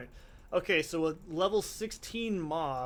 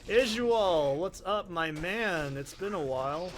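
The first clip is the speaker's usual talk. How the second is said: mock-friendly greeting; 2 semitones higher, 4 dB louder than usual. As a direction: call out to a monster like a buddy. Greets the game boss jokingly.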